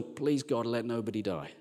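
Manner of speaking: low, soft plea to God; subdued, pleading murmur; hushed prayer. Acted. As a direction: pray quietly under your breath. Acted medic's prayer in hospital.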